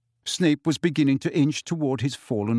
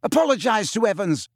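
higher, forceful threatening order; roaring; 8 semitones higher than usual. A narrator's reading. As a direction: order him to apologise, roaring. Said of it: "James roared at Snape".

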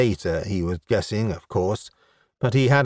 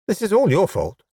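high, scolding accusation; accusing; 9 semitones higher than usual. Acted. Scowling elf woman blaming the human stranger, accusing. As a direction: lay the blame, pitched well up.

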